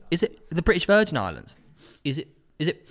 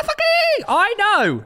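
excited outburst; 4 dB louder, much slower than usual. Real, not acted. Realises he was right.

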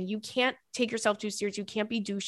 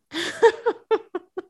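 high, loud helpless laugh; burst of laughter; 10 semitones higher, 4 dB louder than usual. Real, not acted. Host laughs at a listener's mismatched couple, helpless laugh. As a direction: crack up out loud. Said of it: "I'm not laughing at you".

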